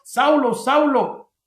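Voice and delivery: preacher acting out an insistent accusation; hypothetical heavenly voice. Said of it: "Ni tampoco dijo la voz".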